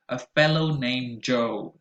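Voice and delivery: slow title with "fellow" and "Joe" stretched; stressed O words. Shown as a demonstration. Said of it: "Notice fellow and Joe".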